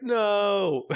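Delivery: high loud wail of dismay; anguished cry. Pretend. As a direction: cry out in horror. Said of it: "they're like".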